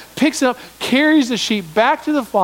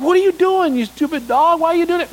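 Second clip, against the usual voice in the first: high, exasperated dog scolding; mock telling-off of a silly pet, pitched high; 9 semitones higher than usual. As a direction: scold the dog like it just did something dumb. Owner scolds dumb dog.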